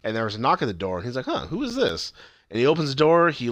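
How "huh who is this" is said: much higher-pitched puzzled question; light, imitated curiosity; retold curious surprise. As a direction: act out wondering who is knocking. Acting Coop answering a knock.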